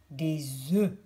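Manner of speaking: Des œufs with a closed, small eu and silent f; closed eu sound. Shown as a demonstration. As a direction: keep the mouth nearly shut on Des œufs. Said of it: "Là, on a des œufs".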